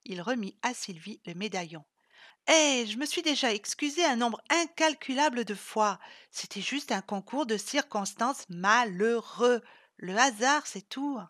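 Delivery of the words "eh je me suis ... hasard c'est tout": loud, higher-pitched self-defence; exasperated, emphatic justification; indignant protest. Acted. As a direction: protest loudly, stretching out the excuses. Defends herself against teasing.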